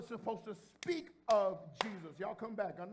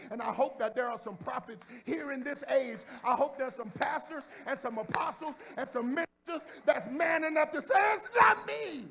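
loud, higher shouted preaching; preaching at full force; 5 semitones higher, 9 dB louder than usual. Acted. Preacher to the church, shouting at full force.

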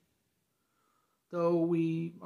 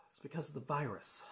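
usual speaking voice

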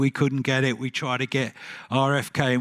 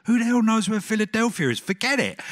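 much higher, scoffing outburst; scornful dismissal; 9 semitones higher, 3 dB louder than usual. Retold or imitated. Bob dismissing Philadelphia to his partner, scoffing outburst. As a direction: brush it off with mock outrage. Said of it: "Bob said".

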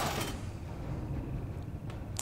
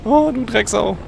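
frantic high yelling; panicking gamer; 8 semitones higher, much slower than usual. Real, not acted. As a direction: yelp and shout in panic. Frantic moment in the game.

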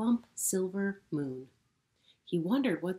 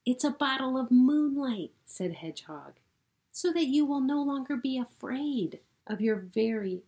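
high, loud, eager voice; proud explaining; 7 semitones higher, 7 dB louder than usual. Acted. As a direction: explain the gift with pride. Hedgehog explains gift.

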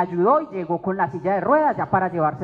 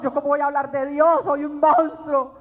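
high, mock-sobbing lament; wailing confession; 4 semitones higher than usual. Speaker re-enacts confessing to her sister-in-law, tearful wailing. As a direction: wail it out, drawing out the last words. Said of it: "comencé a contarle todo".

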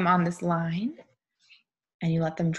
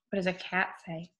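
usual speaking voice

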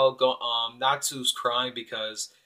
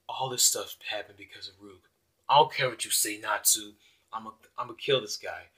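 grim quiet vow; 4 semitones lower, 6 dB quieter than usual. Acted. Grim character vows revenge.

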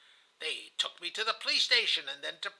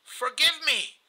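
higher, louder plea; pleading; 8 semitones higher, 6 dB louder than usual. A narrator's reading. Husband imagining asking his dying wife's pardon, pleading. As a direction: beg with a raised voice. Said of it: "approaching my face to her hand and saying".

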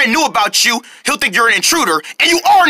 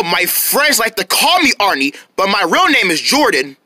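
usual speaking voice

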